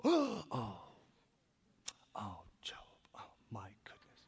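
hushed, breathy gasp of dismay; an appalled little whisper behind the hand; shocked whisper. Retold or imitated. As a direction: whisper it as if aghast. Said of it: "Putting their hands over their mouth and sighing".

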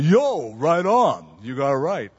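Philadelphia tough-guy voice, punchy; Rocky. Retold or imitated. Friend from Philadelphia answering the waiter, Rocky-style voice. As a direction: imitate a Philadelphia buddy's hearty agreement. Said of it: "he's talked like, you know, Rocky".

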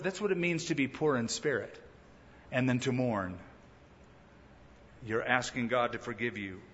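ordinary talk, nothing marked

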